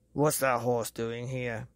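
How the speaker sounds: slow, angry father's shout; a father barking at his son. A narrator's reading. Angry father shouts at Billy over horse. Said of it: "he shouted".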